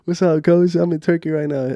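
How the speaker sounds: cheerful phone hello, replayed; super happy. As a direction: act out happily answering the coach. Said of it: "Yeah, super happy".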